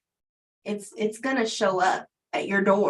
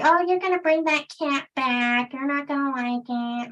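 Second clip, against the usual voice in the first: high, loud mocking skeptical voice; doubtful coworkers; 6 semitones higher, 4 dB louder than usual. Coworkers scoffing that the cat will come back, in a high mocking voice. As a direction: mimic the doubters who are sure she'll fail. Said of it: "everybody's like".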